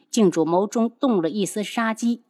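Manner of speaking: usual speaking voice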